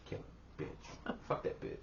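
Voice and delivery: whispered joking threat; soft whispered mock threat; mock menacing whisper. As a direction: hiss it like a pretend villain. Mock murderous aside.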